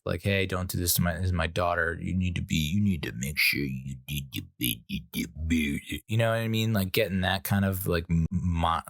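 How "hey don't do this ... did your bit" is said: lowered voice sliding into mumbled nonsense; mock tough dad laying down rules; tough guy act. Dad warning the new boyfriend, mock tough guy. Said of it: "put on this tough guy act".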